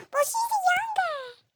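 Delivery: very high, slow, whiny mock of dad. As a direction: parody dad's excuse with a fake whimper. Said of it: "my dad's like".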